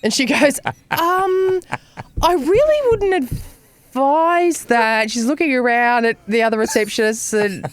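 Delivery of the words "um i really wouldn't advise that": higher, slow, cautious receptionist voice; hesitant receptionist. Retold or imitated. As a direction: draw it out as a wary receptionist, pitched up. Receptionist cautiously advising against.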